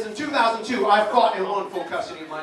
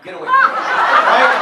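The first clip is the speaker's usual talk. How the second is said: much higher and louder panicked shout; mock freaked-out yell; 10 dB louder, slower than usual. Dad panicking at his daughter's period, higher and louder. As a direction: shriek like a dad who wants out.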